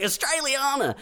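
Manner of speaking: imitating a caller's broad Aussie accent; Australian accent. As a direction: ham up the Aussie twang. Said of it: "He's like".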